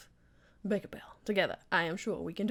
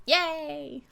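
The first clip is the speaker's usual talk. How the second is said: happy cheer; 8 semitones higher, 5 dB louder than usual. Real, not acted. Cheering at the vow.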